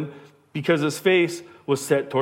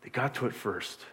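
hushed, softer grumble of envy; hushed envious grumble; 4 semitones lower, 11 dB quieter than usual. Retold or imitated. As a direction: grumble it under your breath like a jealous disciple. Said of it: "They asked".

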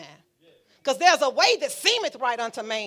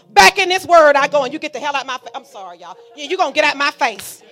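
loud, rising, high commands to the devil; preaching at full force; 3 semitones higher, 9 dB louder than usual. Acted. Preacher shouting the devil out of her face, loud and high. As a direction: drive the enemy off with your voice.

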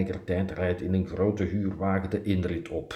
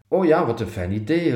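louder, higher, eager exclamation; raised-voice enthusiastic yes; exclaimed reply; 5 semitones higher, 7 dB louder than usual. A narrator's reading. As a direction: call it out keenly. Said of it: "riep ik".

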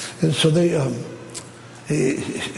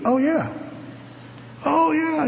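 eager agreement; 14 semitones higher, 3 dB quieter, slower than usual. Retold or imitated. Pastor eagerly agrees to a visit.

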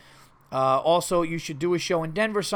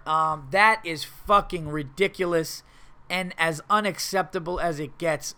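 ordinary talk, nothing marked

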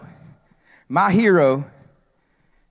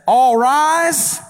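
bailiff's call; 8 semitones higher, 4 dB louder than usual. Retold or imitated. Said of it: "Bird stands up and says".

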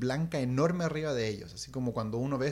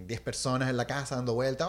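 plain reading voice

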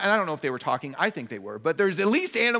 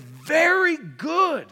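high, slow, drawn-out proclamation; voice of God; 11 semitones higher, 5 dB louder, much slower than usual. Acted. Preacher voicing God approving creation, slow and high. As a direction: savor each word like a proud creator.

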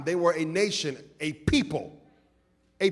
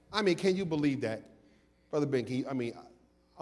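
ordinary talk

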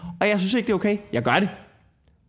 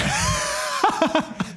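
a high whooping laugh; high shout of a laugh; shrieking laugh. Real, not acted. Bursting out after the rant.

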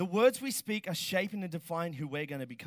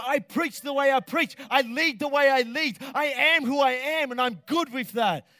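loud, high, declaring preacher; full-force preaching; 8 semitones higher, 10 dB louder than usual. Acted. To the congregation about self-worth, a loud preaching shout. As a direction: proclaim it to the whole room.

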